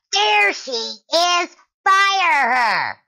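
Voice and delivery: imitated shrill screech, very high and drawn out. Karen spots the shopper across the store, mock shrill shriek. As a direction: screech it slowly like an outraged Karen. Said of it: "hear this shrill voice go".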